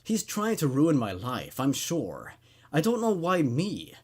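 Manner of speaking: usual speaking voice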